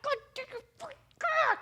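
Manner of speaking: very high, strangled screech held back in volume; re-enacted squeaky tirade; furious cussing out. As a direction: screech curses under your breath. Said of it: "just cussed him out".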